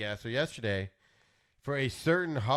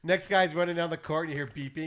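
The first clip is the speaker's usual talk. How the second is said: ordinary talk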